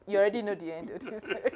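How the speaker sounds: quick line breaking into laughter; amused, laughing. Real, not acted. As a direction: laugh through the words. Storyteller laughs at obvious ending.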